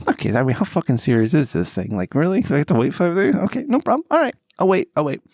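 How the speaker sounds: climbs to a high, mocking squeak on "no problem"; sarcastic, pitch jumping way up; exasperated fake compliance; mock resigned. Retold frustration with doctor's answer.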